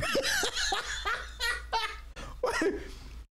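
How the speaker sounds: a high burst of laughter; high cackling laugh. Real, not acted. Laughing at the streamer he mocks, cackling.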